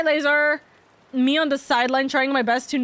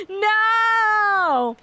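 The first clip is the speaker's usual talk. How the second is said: mock wail; 9 semitones higher than usual. Dramatic outburst.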